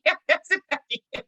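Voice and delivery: high, laughing yeah; cracking up at the student's Thanksgiving answer; laughing agreement. Real, not acted.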